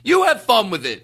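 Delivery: high, indignant mock retort; mock-angry comeback; put-on anger. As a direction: throw it back in fake outrage. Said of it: "making this sort of anger up".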